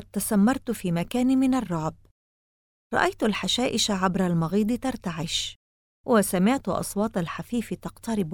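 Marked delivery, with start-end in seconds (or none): none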